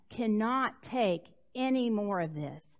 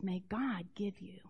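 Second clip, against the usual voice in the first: gentle blessing; 5 semitones lower, 12 dB quieter than usual. Acted. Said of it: "And he says".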